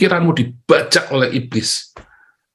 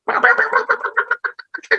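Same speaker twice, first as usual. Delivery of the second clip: squawk. Retold or imitated. Mocking Donald Duck squawk.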